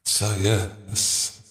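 soft late-night talk; sleepy three in the morning voice; quiet. Real, not acted. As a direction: keep it down. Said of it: "trying to be quiet".

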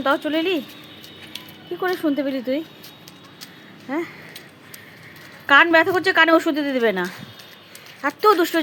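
loud, high pet talk, coaxing the cat outside. Real, not acted. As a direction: call out to the cat, high and bright. Coaxing the cat loudly outdoors.

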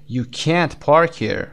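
slow, with can't drawn out long; stress on can't. Shown as a demonstration. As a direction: stretch can't with a full clear vowel. (can't کشیده و با تأکید)

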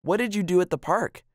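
put-on high, eager child's question. A narrator's reading. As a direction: ask brightly like a curious little girl. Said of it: "she asked eagerly".

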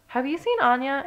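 high, urgent question; mildly frantic child's question; desperate. A narrator's reading. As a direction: ask desperately, pitch lifted. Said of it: "asked her mother desperately".

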